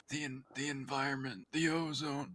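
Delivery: faint, high voice from the dark; faint distant voice. Acted. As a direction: squeak it out far and faint. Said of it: "Just a faint voice coming out of the dark".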